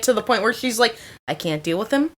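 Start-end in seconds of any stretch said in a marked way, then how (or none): 1.3-2.1 low, soft, weary aside; understated exasperation. Retold or imitated. Girlfriend confiding about her drunk boyfriend, low and resigned. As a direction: give up under your breath, pitched down. Girlfriend fed up, retold, first take.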